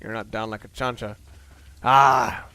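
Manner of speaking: ordinary talk, nothing marked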